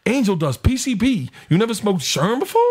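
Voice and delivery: high, incredulous put-on voice; mock-amazed character voice; retold comic dealer. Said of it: "he's like".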